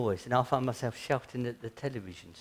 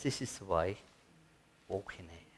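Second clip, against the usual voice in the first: soft, lower fading voice of God; still small voice; 5 semitones lower, 6 dB quieter than usual. Retold or imitated. Preacher voicing God's still small voice to the church, soft and fading. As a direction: let it fade to a hush. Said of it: "that still small voice".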